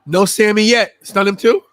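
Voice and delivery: excited loud. Real, not acted. Hyped reactions to live match.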